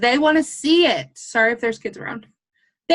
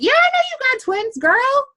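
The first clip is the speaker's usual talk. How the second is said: excited gushing; 11 semitones higher than usual. Retold or imitated. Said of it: "I was like".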